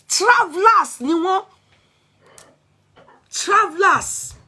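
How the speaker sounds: slow, high and emphatic scorn; scornful. Real, not acted. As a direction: drag the word out with contempt. Bitter complaint about neighbours.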